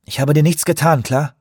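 lauter und höher, verärgert; zornig. A narrator's reading. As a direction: sich zornig verteidigen. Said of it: "Sie funkelte ihn zornig an". (zornige Gegenwehr)

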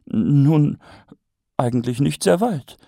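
answer, a bit bewildered; Lady Ramkin, mildly puzzled. A narrator's reading. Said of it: "entgegnete Lady Käsedick verwundert".

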